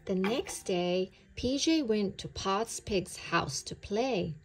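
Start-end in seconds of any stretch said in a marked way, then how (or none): none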